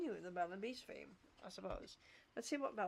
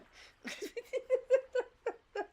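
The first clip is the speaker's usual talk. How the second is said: high, loud burst of laughter; real rippling laugh; 13 semitones higher, 9 dB louder than usual. Real, not acted. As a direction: laugh out loud for a couple of seconds. Laughs at own benefits situation.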